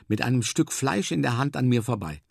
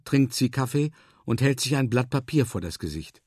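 usual speaking voice

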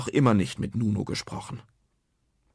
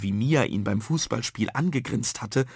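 ordinary delivery, nothing marked

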